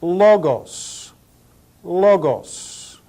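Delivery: "logos" said LAW-goss, stress up front; pronunciation demo. Shown as a demonstration. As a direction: model "logos" as LAW-goss, leaving room to repeat. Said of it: "pronounce them all again".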